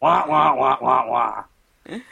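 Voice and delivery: squawky bouncy penguin wah; lightly squawked penguin noise. Retold or imitated. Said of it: "you have to do the penguin voice".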